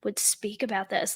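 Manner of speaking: hushed mock whine, imitating him; mocking whisper. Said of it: "he's like".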